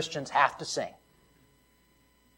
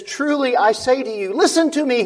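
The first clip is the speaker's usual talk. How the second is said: louder, higher, driving each line home; full-force preaching; 9 semitones higher, 7 dB louder than usual. Acted. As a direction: preach it hard, hammering every phrase. Quoting Jesus at full force.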